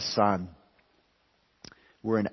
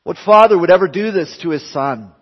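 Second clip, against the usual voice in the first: louder, higher mock outrage; indignant objection; 7 semitones higher, 9 dB louder than usual. Critics of the cross objecting, imitated indignant outrage. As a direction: voice the critics' shocked protest. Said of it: "some have tragically concluded and said".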